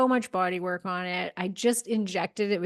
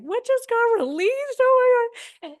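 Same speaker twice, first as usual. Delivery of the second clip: much higher and louder, stunned; shocked exclamation; 6 dB louder than usual. Retold or imitated. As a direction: blurt it out as if sudden tears caught you off guard. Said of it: "you're crying and you're like".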